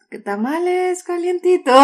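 crackly looped vendor recording. Retold or imitated. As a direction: sing out the hot tamales like a loudspeaker. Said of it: "El de".

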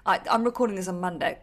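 ordinary talk, nothing marked

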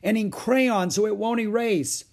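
high, loud, dismayed tattling; upset child's loud complaint; crying out. A narrator's reading. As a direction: report the outrage to Mother. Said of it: "cried Beezus".

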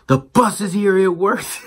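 put-on high, far-off childlike voice for the boy; excited little kid. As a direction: play the kindergartner, thrilled the bus came. Kid cheering as bus arrives.

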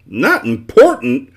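slow, loud, incredulous roar; outraged roar. A narrator's reading. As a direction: bellow the words back in outrage. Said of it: "roared the dodecahedron".

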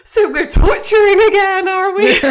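high, loud, laughing through the quote; laughing mock outrage. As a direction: play outraged but crack up laughing. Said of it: "Dean's outraged".

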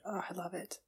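ordinary talk, nothing marked

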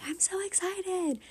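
high, hushed squeal of excitement; slow, airy, thrilled little squeal; excited. Retold or imitated. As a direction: stretch it out, high and whispery. Retelling own excitement over ticket.

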